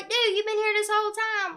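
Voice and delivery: high, loud and fast, playfully calling him out; mock-exasperated shout at a lurking friend. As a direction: tease him like you just caught him lurking. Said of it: "I'm like".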